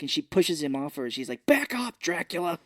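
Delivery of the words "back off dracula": higher, sharp retold warning; mock angry rebuff. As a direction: push someone away with a raised, imitated voice. Retells woman pushing man away.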